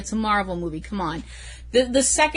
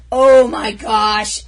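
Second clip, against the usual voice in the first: slow, louder gushing oh my gosh; excited; 7 dB louder, much slower than usual. Real, not acted. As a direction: gush over him. Raving about actor.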